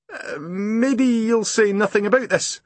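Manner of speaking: high, breathless Scots voice, pouring out the story; dripping postman tells Grumble his boat sank, high and upset; shaken Scottish postman. Acted. Postman telling of his sunk boat, pitched high with alarm. Posty asks for silence.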